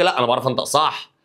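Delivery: ordinary talk, nothing marked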